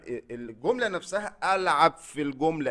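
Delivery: normal talking voice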